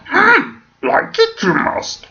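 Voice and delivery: very high, croaky little creature voice; hammed-up impression of a tiny alien sage; Yoda. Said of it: "That's because they're doing Yoda".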